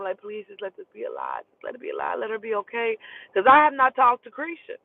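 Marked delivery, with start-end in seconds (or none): 0.2-3.0 retold praying. Praying on the drive that it is a lie, hushed pleading. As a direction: plead under your breath. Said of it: "I'm, like, praying this whole time".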